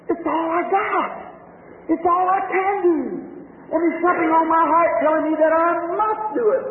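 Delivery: high, crying words of a weeping woman; tearful plea. Acted. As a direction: sob it out, pleading. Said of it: "I don't know why I'm weeping".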